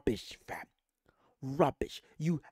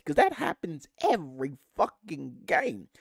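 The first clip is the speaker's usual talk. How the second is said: ordinary delivery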